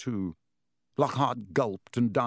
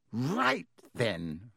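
Lockhart voice. A narrator's reading.

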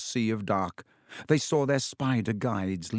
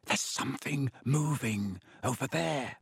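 softer breathed warning; hushed frightened breath; 2 semitones higher, 6 dB quieter than usual. A narrator's reading. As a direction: barely breathe the words out. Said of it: "Harry breathed".

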